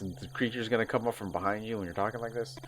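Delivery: ordinary talk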